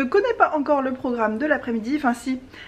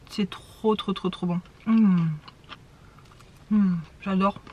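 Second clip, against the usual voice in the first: mouth full; 2 semitones lower, slower than usual. Real, not acted. Savouring the food, mouth full.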